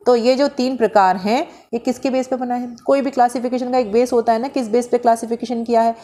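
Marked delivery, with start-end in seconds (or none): none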